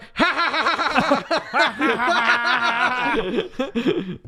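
long, loud, high cackling laugh; hammed-up extended laugh at someone; mock gloating laugh. Imitated biker's mocking laugh.

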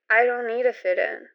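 normal talking voice

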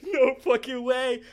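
high, loud, drawn-out disbelief; freaking out. Retold or imitated. Driver told his car will be towed, high-pitched freaked-out protest. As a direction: stretch it out in retold panic. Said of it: "I was freaking out".

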